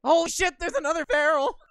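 high, loud startled cry; shocked shout. Real, not acted. New enemy appears.

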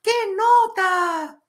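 very high, loud, drawn-out squeal; hammed-up high youtuber voice; youtuber impression. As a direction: shriek it like an excited kid youtuber. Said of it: "un youtuber muy famoso que ustedes siguen que dice".

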